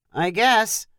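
ordinary delivery, nothing marked

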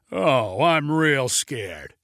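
slow, drawn-out mock fear; sarcastic. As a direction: lay the sarcasm on thick. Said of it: "said Kevin sarcastically".

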